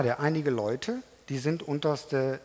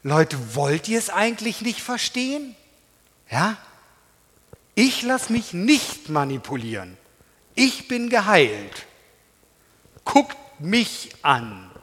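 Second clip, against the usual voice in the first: bold, louder defiance with a very slow end; defiant; 3 semitones higher, 4 dB louder than usual. Acted. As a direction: stand firm and face them down. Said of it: "hat der die Motivation zu sagen". (der Blinde widerspricht trotzig den Richtern)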